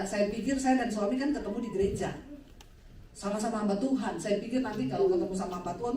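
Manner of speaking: ordinary talk, nothing marked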